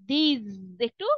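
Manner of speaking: "these" drawn out slowly, ending on a buzzing z; z sound at the end. Shown as a demonstration. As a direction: stretch "these" and land on a clear z. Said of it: "জ sound হবে লাস্টে".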